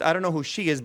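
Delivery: usual speaking voice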